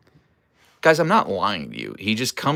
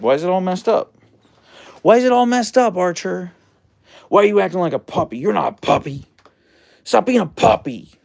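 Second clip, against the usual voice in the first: high, louder mock scold; playful telling-off of the husky; 8 semitones higher, 5 dB louder than usual. Real, not acted. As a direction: tell off the puppy playfully. Scolding the dog over bed.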